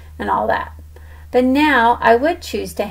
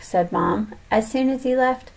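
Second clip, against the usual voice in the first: usual speaking voice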